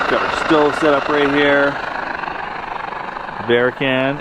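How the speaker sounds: ordinary talk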